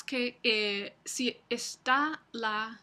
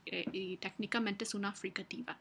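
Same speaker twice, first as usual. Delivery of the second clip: ordinary talk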